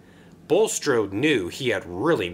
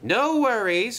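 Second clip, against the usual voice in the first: high, reassuring call; 10 semitones higher than usual. A narrator's reading. Engine calling to another stuck on the hill, reassuring.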